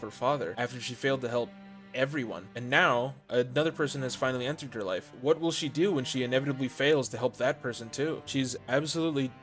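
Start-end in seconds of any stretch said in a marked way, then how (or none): none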